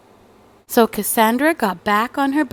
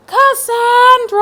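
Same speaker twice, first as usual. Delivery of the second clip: much higher, louder, drawn-out shout; parents yelling a name; 13 semitones higher, 9 dB louder, slower than usual. Acted. Parents seeing the school in the backyard, shouting Cassandra's name. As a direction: bellow the name in shock. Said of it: "they both yelled".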